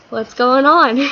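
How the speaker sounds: loud mock-bewildered outburst; bewildered cry. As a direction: burst out in comic confusion. Bewildered son just disowned.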